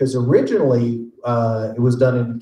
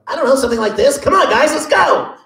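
very high, squeaky Popeye voice; a sailor on helium, all squeak; high squeaky Popeye calling the guys on; 13 semitones higher, 4 dB louder than usual. Retold or imitated. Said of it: "Popeye on helium".

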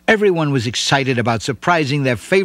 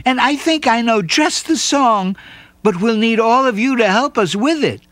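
put-on much higher eager girl; chirpy child voice; 9 semitones higher than usual. Nancy explains the song.

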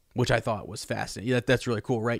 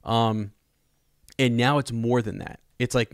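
usual speaking voice

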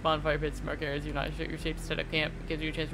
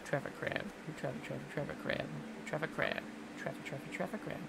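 much softer, half-whispered chant of "traffic crab"; muttering "traffic crab" under the breath; quiet repetition; 4 semitones lower, 13 dB quieter than usual. Real, not acted. Streamer repeating the enemy's name, softly muttered. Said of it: "muttering the name over".

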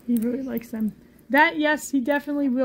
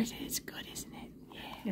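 soft hushed whisper to the bird; 2 semitones lower, 19 dB quieter than usual. Real, not acted. Owner whispers to her bird while he eats. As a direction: whisper it intimately.

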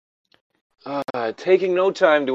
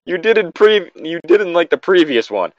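much higher, louder teasing; excited teasing; 7 semitones higher, 4 dB louder than usual. Real, not acted. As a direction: push the point gleefully. Teasing friend, laughing.

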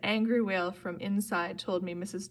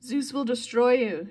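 raised, loud threat; threatening promise; 4 semitones higher, 6 dB louder than usual. A narrator's reading. Missus Dodds, pinned down, threatens Percy, menacing. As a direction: promise doom loudly and higher. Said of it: "she promised".

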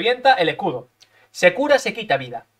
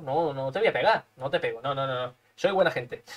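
much lower and much softer; hushed teasing reassurance; soft mock mercy; 7 semitones lower, 8 dB quieter than usual. Real, not acted. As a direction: murmur it like a fake saint. Mock gentle to the rival.

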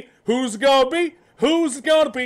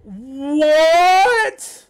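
long rising cry of disbelief; swelling, incredulous outburst; utter shock. Real, not acted. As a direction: stretch it out, climbing into a shout. Shocked reaction to the trailer.

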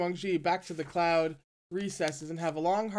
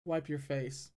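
softer, curt order; cold; 2 semitones lower, 5 dB quieter, slower than usual. A narrator's reading. Uncle coldly telling his nephew to clean up. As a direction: brush the kid off coldly. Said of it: "said coldly".

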